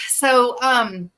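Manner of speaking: ordinary delivery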